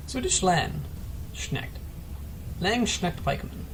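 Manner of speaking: "Swedish Landsknecht" whispered slowly, sounding out each part; whispered careful try. Shown as a demonstration. Caster whispering a careful try at a foreign name. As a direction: breathe "Landsknecht" out carefully, unsure. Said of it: "I'm really trying".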